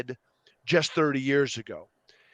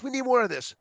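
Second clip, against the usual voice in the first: begging again; 9 semitones higher, faster than usual. Retold or imitated. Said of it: "they will beg us".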